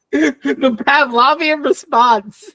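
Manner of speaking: talking through a laugh; amused laughter. Real, not acted. Co-host amused by friend's song reaction, laughing.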